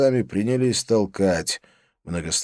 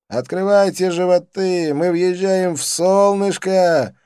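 high, loud, drawn-out proclamation; exuberant calling; 9 semitones higher, 6 dB louder, slower than usual. A narrator's reading. Dean driving friends into the sun, gleeful calling. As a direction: call it out in glee, stretching the end.

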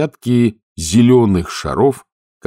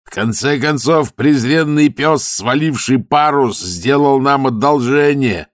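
shouting over wind; 7 semitones higher, slower than usual. A narrator's reading. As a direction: shout against the roar of the storm. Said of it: "прокричал он сквозь рёв ветра". (капитан кричит сквозь рёв ветра)

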